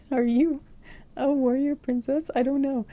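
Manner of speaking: amused, lifted voice with real laughter; playful laughing. Real, not acted. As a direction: ask it through a laugh. Laughing at own playful guess.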